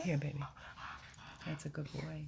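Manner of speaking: hushed loving voice to a dog; soft pet talk. Real, not acted. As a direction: soothe him with quiet praise. Praising the dog softly.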